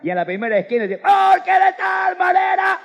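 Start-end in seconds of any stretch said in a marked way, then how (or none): 1.0-2.8 high, loud startled yelp; mock startled cry. Preacher acting a startled believer crying out on the street. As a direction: cry out as if fleeing a roaring lion. Frightened man on street corner.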